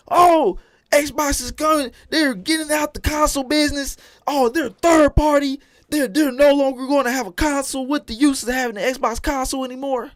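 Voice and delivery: high, loud mocking whine of a fan; mocking whiny fan. Streamer mocking Xbox fans running their mouths, high whiny mock voice. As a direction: lampoon the complainers in a high whine.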